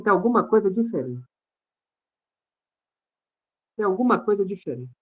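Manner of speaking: soft, musing self-talk; hushed realization. Retold or imitated. Man telling himself something changed the morning after praying, hushed realization. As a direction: murmur it to yourself, puzzled.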